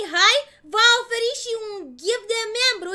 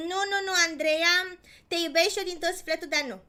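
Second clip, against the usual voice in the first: ordinary talk, nothing marked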